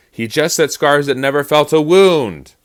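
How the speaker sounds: loud, high, indignant line of verse; yelling at a friend. Acted. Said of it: "He's yelling at his friend".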